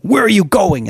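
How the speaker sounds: high, loud demanding question; hammed-up bossy uncle; mock stern uncle. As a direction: bark it like a strict uncle. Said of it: "he was saying".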